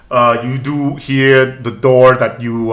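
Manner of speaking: ordinary talk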